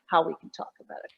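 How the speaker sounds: whispering. Real, not acted. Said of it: "drops to whisper".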